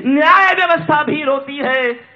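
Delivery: high, loud poetic lament; impassioned verse. Acted. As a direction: cry the line out to the hall. Same line belted, then repeated steadily.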